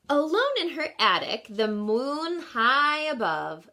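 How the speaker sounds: lilting storybook read-aloud; gentle picture-book narration; reading to children. Acted. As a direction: read the rhyme to a class.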